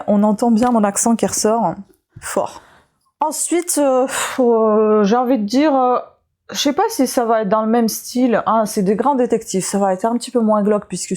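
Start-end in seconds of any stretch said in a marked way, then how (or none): none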